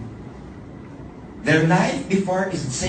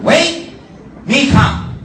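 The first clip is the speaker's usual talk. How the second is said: even higher, louder broken English; 12 semitones higher, 10 dB louder, much slower than usual. Retold or imitated. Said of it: "Because it's Barong English".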